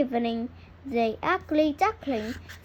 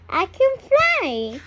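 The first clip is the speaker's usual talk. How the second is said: high and louder, delighted; joyful duckling; 6 semitones higher, 5 dB louder than usual. A narrator's reading. Duckling finds he can fly, high joyful voice. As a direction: say it with wonder. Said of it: "says the ugly duckling".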